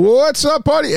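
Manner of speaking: replaying the muted high hello; mock hype greeting. Host retelling his muted-mic hello, high and excited. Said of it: "started saying".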